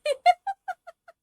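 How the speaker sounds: loud high cackle; high burst of laughter. Real, not acted. Laughs at his own bit.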